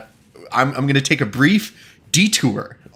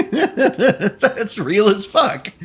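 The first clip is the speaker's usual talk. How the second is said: laughing; 9 semitones higher, much slower than usual. Real, not acted. Laughs at own Swedish fish joke.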